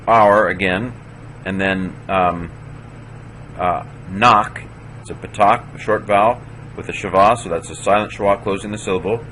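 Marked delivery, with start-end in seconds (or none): none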